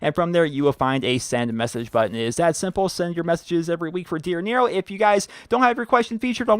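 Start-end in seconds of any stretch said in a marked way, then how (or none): none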